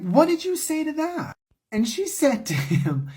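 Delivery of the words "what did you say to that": much higher, stunned asking what she said; shocked disbelief. Real, not acted. Godmother asking the goddaughter in shock, stunned high voice.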